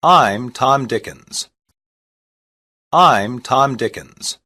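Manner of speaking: ordinary talk, nothing marked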